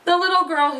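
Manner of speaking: plain reading voice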